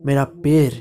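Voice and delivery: ordinary delivery, nothing marked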